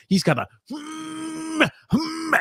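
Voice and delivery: long high strained hum, slow; mock straining grunt held high. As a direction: hum like someone straining on the toilet. Imitating a straining face.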